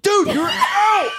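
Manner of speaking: high, slow, laughing shout; high slow exasperated shout. Retold or imitated. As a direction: drag it out in disbelief. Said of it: "I'm like".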